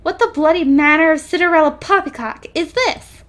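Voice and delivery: mildly outraged posh inventor; indignant old Englishman. Acted. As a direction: sputter it out like a scandalised professor. Character's outraged exclamation read aloud.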